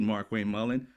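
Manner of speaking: normal talking voice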